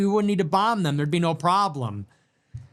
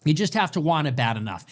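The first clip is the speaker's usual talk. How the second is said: ordinary talk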